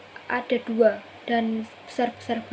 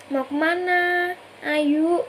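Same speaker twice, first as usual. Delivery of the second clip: lightly acted, higher and slow coaxing; imitating a friend going along with it; playing along; 6 semitones higher, much slower than usual. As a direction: humour them with a drawn-out invitation. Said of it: "teman saya juga malah ngeladenin dia".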